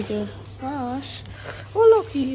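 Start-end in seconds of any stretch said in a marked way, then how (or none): 1.8-2.3 high, loud call across the yard; loud high call to a pet; calling the dog. Real, not acted. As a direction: shout out to get the dog's attention.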